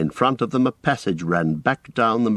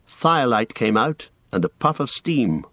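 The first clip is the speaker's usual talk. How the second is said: plain reading voice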